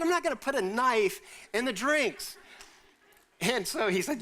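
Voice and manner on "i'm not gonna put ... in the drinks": higher, indignant disbelief; taken-aback protest; incredulous retort. Retold or imitated. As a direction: scoff at the absurd idea.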